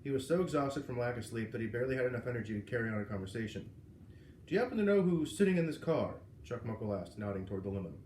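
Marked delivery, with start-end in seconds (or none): 4.5-6.1 loud, higher self-important voice; a lightly voiced exec pressing a tired cop; pompous executive. A narrator's reading. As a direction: press him like a smug boss. Pompous executive questioning officer.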